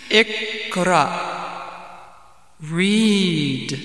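drawn-out, booming command; mysterious commanding voice. Acted. Voice in the dark cave orders again, commanding. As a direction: intone it from out of the darkness. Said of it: "the mysterious voice came out".

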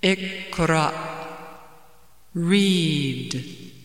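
slow, drawn-out mysterious command. Acted. Mysterious voice orders the man to read, commanding. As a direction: intone it as a voice out of the darkness. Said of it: "a commanding voice said".

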